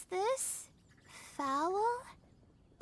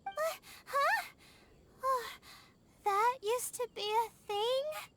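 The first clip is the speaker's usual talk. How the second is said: shocked disbelief; 7 semitones higher than usual. Acted. Shocked by the news.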